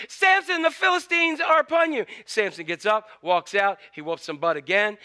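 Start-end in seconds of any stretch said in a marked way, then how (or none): 0.1-2.0 high, raised call of alarm; hammed-up warning cry, pitched well up; mock yell. Delilah waking Samson again, high mock yell. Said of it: "And she yells".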